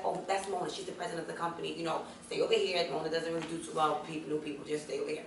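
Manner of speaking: low, soft warning voice; hushed warning. Retold or imitated. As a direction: whisper a warning aside. Receptionist warns quietly.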